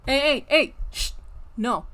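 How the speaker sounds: loud, sharp hey to stop a cat; sharp scolding. Real, not acted. Streamer scolding her cat off the laptop, loud and sharp. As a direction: bark it out at the cat.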